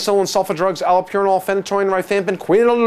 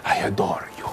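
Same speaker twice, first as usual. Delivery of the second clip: hushed, lower lover's whisper; mock love whisper; 11 semitones lower, 7 dB quieter, faster than usual. Lecturer confides his love for eosinophils, mock whisper. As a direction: breathe it like a secret confession.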